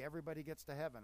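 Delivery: normal talking voice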